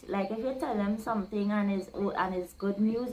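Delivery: normal talking voice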